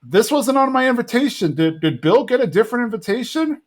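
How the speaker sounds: high-pitched baffled questioning; puzzled, high mock complaint; confused Dale. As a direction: imitate bewilderment, pitched well up. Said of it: "And Dale's confused".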